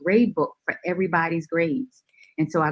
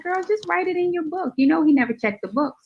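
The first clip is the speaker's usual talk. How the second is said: much higher put-on girlfriend voice; classmate's advice; 12 semitones higher, 3 dB louder than usual. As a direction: play the friend egging her on, pitched way up. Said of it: "And she's like".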